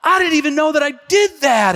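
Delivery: friend blurting out his surprise; mock stunned shout; shocked friend. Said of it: "He was like".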